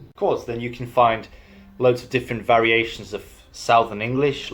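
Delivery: ordinary delivery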